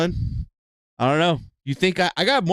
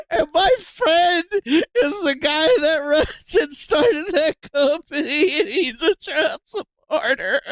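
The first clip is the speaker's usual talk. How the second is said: talking through laughter; 7 semitones higher than usual. Real, not acted. Streamer telling the pine cone story through giggles. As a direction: keep laughing as you tell it.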